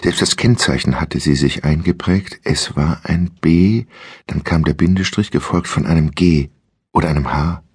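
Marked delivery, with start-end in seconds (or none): none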